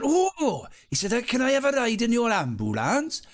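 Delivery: put-on high, eager little Welsh boy; excited Welsh child. Nephew begs the injured man for an ambulance ride, high eager Welsh child voice. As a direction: beg for the ride like a thrilled Welsh kid. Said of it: "He was Welsh".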